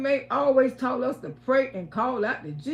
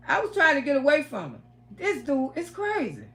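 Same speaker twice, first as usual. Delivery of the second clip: usual speaking voice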